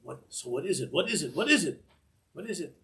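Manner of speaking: from curious to bursting with suspense; a student who can't wait for the answer; building excitement. Retold or imitated. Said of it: "that unbelievable feeling of".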